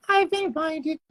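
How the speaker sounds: high falsetto cartoon mouse; Mickey Mouse. Streamer announcing an invitation in squeaky cartoon mouse voice. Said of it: "do your Mickey Mouse voice".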